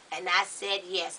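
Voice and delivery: normal talking voice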